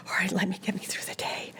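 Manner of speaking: hushed nervous whisper. Retold or imitated. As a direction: whisper it to yourself. Nervous self-talk on set.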